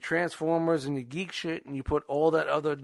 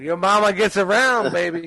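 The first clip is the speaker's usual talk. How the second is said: loud teasing; 2 semitones higher, 7 dB louder than usual. Pretend. Teasing punchline shouted.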